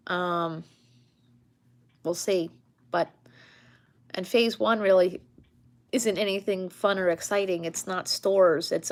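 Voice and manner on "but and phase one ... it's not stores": normal talking voice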